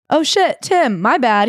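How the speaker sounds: high, loud startled "Oh shit"; shocked apology. Retold or imitated. Coworker who fired by accident, loud shocked apology. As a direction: blurt it out in sudden shock.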